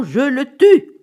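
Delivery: loud, high outburst; furious threat. Acted. Wife vowing revenge on the pawnbroker, furious cry. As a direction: explode on the final threat.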